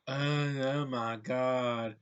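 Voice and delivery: slow, drawn-out mock Southern belle; mock Southern accent. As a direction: mimic Megan Fox's slipping Southern accent, slowly. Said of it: "She does a southern accent".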